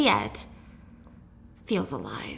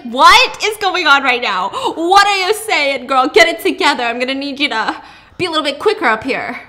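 exasperated; 4 semitones higher, 8 dB louder than usual. Real, not acted. Host scolds the heroine jokingly.

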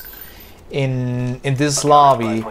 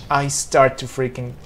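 normal talking voice

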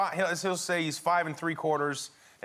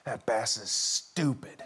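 put-on kid's slow complaint; 4 semitones lower, slower than usual. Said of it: "he goes".

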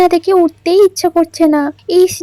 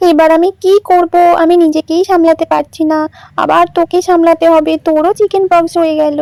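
ordinary delivery

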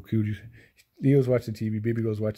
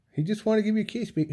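higher, quick sweet coo; 9 semitones higher, faster than usual. Real, not acted. Owner coos to jealous cat in higher baby talk.

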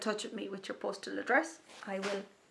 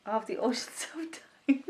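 announcing while being tickled, higher; 3 semitones higher than usual. Real, not acted. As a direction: squirm and giggle through the line. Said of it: "oh, he's tickling me".

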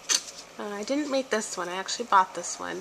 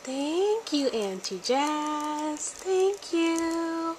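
put-on much higher, slow little-girl voice; baby voice for doll; 7 semitones higher, much slower than usual. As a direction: voice the baby doll saying thanks. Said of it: "So say".

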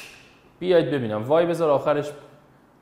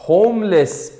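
تأکید بلند; 7 semitones higher, 11 dB louder, much slower than usual. Shown as a demonstration. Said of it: "باریکلا [name]،".

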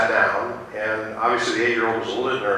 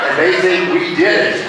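laughing punchline; 6 semitones higher, 5 dB louder than usual. Real, not acted.

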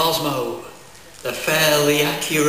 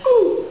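high, loud comic yelp as the switch is pressed; mock shocked yelp; 5 dB louder than usual. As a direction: squeal like you've just been zapped. Electric shock during torture.

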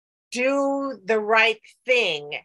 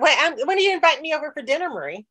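fast, laughing, higher; playful hinting while laughing; laughing tease; 5 semitones higher, much faster than usual. Real, not acted. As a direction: tease her for a dinner invite. Teasing invitation request.

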